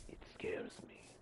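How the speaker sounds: mock-frightened whisper; scared whisper. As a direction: whisper it like the thing might hear you. Scared of the baby sheep.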